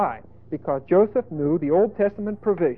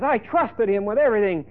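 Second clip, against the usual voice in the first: acted indignation, higher pitched; higher, heated mock outrage; steamed husband; 5 semitones higher, 3 dB louder than usual. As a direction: play a betrayed, fuming husband. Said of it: "And Potiphar is steamed".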